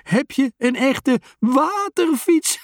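high, slow words through giggles; laughing. A narrator's reading. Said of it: "proest hij".

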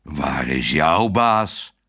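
low and slow, pressing the question; a guard dog demanding an answer; menacing dog. A narrator's reading. Dog Herman interrogating Otje, low and slow. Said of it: "zei Herman dreigend".

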